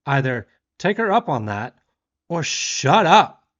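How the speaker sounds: retold indignant confrontation; exasperated scolding. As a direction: lecture a rude stranger, ending in a snap. Argument with diner, ends in shut up.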